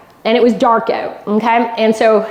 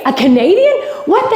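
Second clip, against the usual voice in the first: mock shout, much higher on the end; imitating crowd screaming; 8 semitones higher than usual. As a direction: act out the crowd's delighted disbelief. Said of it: "they start screaming".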